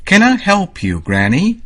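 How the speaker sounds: lightly voiced girl. A narrator's reading. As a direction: sound like a kind little girl offering help. Girl offers help to granny.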